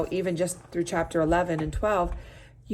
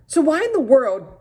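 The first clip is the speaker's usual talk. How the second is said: gasping mom; 8 semitones higher, 9 dB louder than usual. Acted. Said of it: "gasped Lulu's mom".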